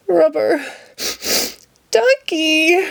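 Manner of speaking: high rising plea for the duck; calling out high for his lost duck; plaintive call. Acted. Ernie calling sadly for his lost rubber duck, high and pleading.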